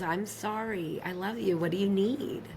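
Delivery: soft, sincere reassurance; calm apology. Retold or imitated. Partner who has calmed down and listened, soft and apologetic. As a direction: let it come out gently and unguarded. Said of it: "I calm down and I listen".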